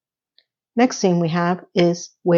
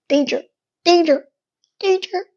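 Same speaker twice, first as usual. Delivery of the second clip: mock alarm; 7 semitones higher than usual. Mock alarm over red flag.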